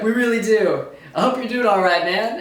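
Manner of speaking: high, loud, overly gushing thanks; high, loud mock-effusive gratitude.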